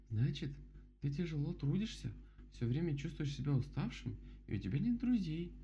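high-voiced bear making a point; story bear, summing up. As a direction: spell it out in a high character voice. (мишка рассуждает высоким голосом)

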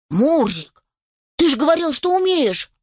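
high-pitched puppy protest; reproachful puppy. Acted. Puppy to kitten stuck in the tree, reproachful.

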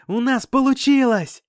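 high, loud triumphant yell; joyful shout. Acted. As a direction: shout the good news to everyone. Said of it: "с криками". (ликующий, громкий крик)

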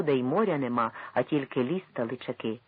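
plain reading voice

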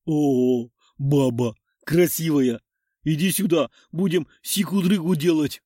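lightly voiced dopey leering troll. A narrator's reading. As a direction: leer like a dim troll. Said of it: "осклабился тролль".

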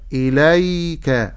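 إليك slow, without lengthening the kaf; correct timing. Shown as a demonstration. Teacher warning against stretching the kaf. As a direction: recite إليك evenly, no extra length on the kaf. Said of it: "ولا أزيد في زمن الياء".

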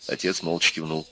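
usual speaking voice

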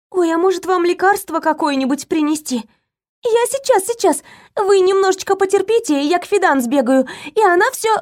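high, quick eager maid voice; flustered maid. A narrator's reading. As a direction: hurry to be helpful, flustered. Maid bustles off to help.

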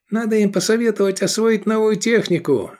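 high, mocking character voice; jokey sidekick; Edik's voice. A narrator's reading. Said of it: "проговорил Эдик".

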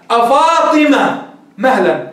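أفاطمة drawn out like a verse; slow recited call. Shown as a demonstration. Teacher reciting a line from Imru' al-Qays. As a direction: recite أفاطمة slowly as poetry. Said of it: "امرئ القيس إيش قال في معلقته".

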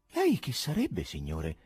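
ordinary delivery, nothing marked

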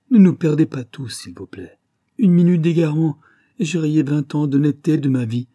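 high, loud begging; anguished outburst of pleading; desperate plea. A narrator's reading. Culprit begs for mercy.

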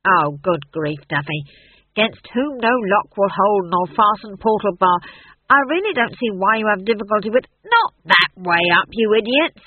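loud, impatient prompting; exasperated director. A narrator's reading. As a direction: rattle off the line, then scold.

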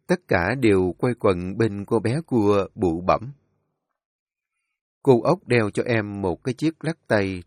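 ordinary delivery

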